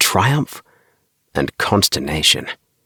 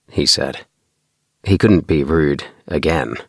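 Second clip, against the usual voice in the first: plain reading voice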